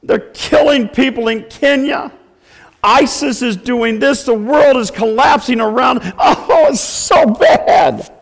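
high, hammed-up whiny lament; mock wailing. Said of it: "they're boo-hooing and they say".

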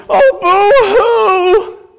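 high, hammed-up fake sobbing; mock boo-hoo. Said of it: "They always stand by and".

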